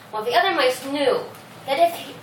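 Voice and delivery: plain reading voice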